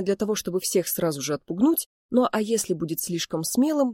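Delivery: plain reading voice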